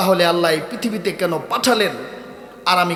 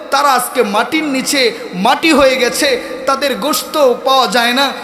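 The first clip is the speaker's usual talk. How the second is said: full-force preaching; 6 semitones higher, 5 dB louder than usual. Real, not acted. Preacher tells how kings and saints are gone, full-force preaching. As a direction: build each item of the roll call. Preaches roll call of dead.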